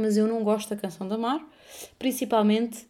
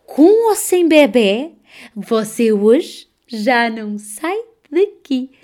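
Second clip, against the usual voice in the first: slow, drawn-out imitated nurse; enfermeira amorosa; 2 semitones higher, 6 dB louder, slower than usual. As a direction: stretch every word, swelling from soothing to emphatic. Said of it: "uma enfermeira amorosa". (enfermeira amorosa, muito arrastada e enfática)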